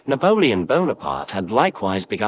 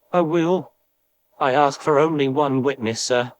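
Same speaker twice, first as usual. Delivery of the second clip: higher, fervent vow; bursting heart; 6 semitones higher than usual. Acted. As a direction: cry out the promise, heart overflowing. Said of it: "cried Richard, with a bursting heart".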